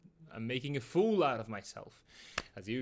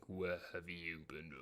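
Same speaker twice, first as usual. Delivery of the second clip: deep, slow, much softer menacing voice; horror movie dad; 9 semitones lower, 12 dB quieter than usual. Retold or imitated. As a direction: growl it like a horror villain. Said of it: "like a horror movie".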